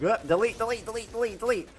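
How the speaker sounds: high, frantic squeaky chant of delete; mock him in a panic, pitch way up; mocking imitation. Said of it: "he's just like".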